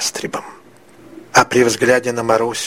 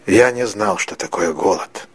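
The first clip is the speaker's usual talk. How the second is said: usual speaking voice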